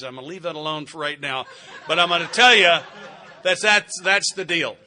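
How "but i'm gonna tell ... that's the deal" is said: laughing. Real, not acted. Laughing aside to the fellas.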